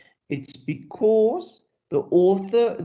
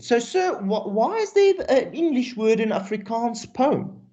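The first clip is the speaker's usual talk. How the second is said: mock pupil question; 6 semitones higher than usual. Teacher voices a pupil's question.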